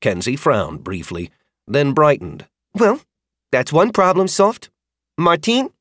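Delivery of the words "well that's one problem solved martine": put-on high, brighter girl voice; perked-up rider; cheerful rider girl.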